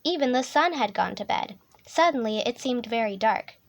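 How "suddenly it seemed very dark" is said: plain reading voice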